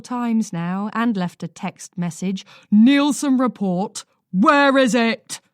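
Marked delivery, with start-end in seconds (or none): 2.7-5.4 slow, loud demanding; angry boss. A narrator's reading. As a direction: manager furious about a late report. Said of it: "Tessa's rage".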